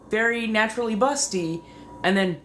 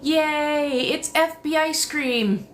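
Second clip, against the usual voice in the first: mock excited cheer; 10 semitones higher, slower than usual. Mock cheer at ice cream truck.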